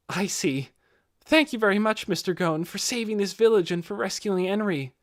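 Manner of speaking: put-on a sincere boy thanking his rescuer, pitched high; earnest young man.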